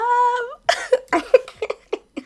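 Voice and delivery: squealing high laugh. Real, not acted. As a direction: let out a high giggle. Laughing at own remark.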